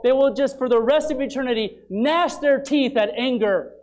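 loud, high, driving sermon delivery; forceful preaching. Acted. Preacher warning his congregation about hell, thundering.

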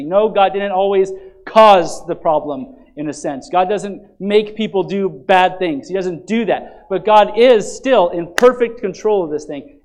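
loud, steady pulpit emphasis; preaching at full force. Acted. Pastor assuring the congregation God controls adversity, loud preaching. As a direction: preach it out with conviction.

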